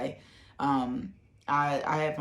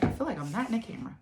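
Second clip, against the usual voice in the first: higher, fast, giggling retake of the line; laughing repeat; 8 semitones higher, much faster than usual. Real, not acted. Telling her viewers she was out of frame, laughing. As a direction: laugh at yourself as you say it again.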